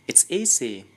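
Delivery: ordinary delivery, nothing marked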